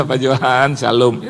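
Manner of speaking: low greeting after a silly chuckle; mock grinning awkwardly through a hello; imitated sheepish giggle. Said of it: "dia cengengesan lagi". (meniru sapaan sambil cengengesan)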